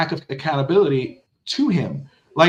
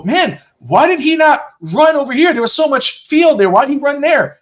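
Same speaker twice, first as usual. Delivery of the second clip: high, louder armchair-fan complaint; mock fan gripe; 9 semitones higher, 4 dB louder than usual. Imitating a fan second-guessing the play, high mock complaint. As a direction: whine like a fan on the couch. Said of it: "You go".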